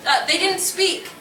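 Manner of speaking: usual speaking voice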